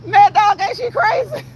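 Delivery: high-pitched words bursting through real laughter; helpless laughter. Real, not acted. Streamer reacting to Joyelle's comment, laughing high and hard. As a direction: crack up while talking.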